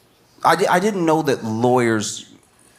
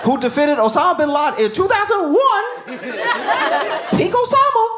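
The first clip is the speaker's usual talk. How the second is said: incredulous; 9 semitones higher than usual. Real, not acted. Comic asking lawyer in disbelief.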